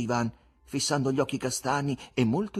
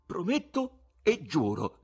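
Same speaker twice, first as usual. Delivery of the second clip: slow, higher solemn oath; solemn vow; 9 semitones higher, slower than usual. A narrator's reading. Ivan promising the guest, solemn. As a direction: swear it ceremoniously, word by word. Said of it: "dichiarò Ivan solennemente".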